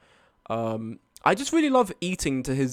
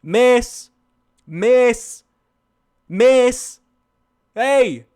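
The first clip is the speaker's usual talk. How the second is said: put-on loud, higher repeated calls to a drunk woman; calling out; 8 semitones higher, 10 dB louder, much slower than usual. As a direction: shout to get her attention. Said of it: "he was like".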